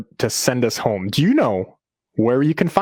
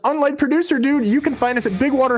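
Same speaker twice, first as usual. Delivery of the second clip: high, hammed-up promo read; hyped announcer voice; 9 semitones higher than usual. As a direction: belt out the sign-off like a hype man. Shows up partner's announcer read.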